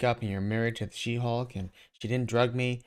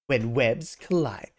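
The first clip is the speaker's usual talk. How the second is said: title announcement; 4 dB louder, slower than usual. Acted.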